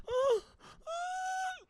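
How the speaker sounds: put-on high wailing "oh" of a hurt kid; imitated child's cry. Dad imitating his son crying to him, high mock wail. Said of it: "like crying like that to me".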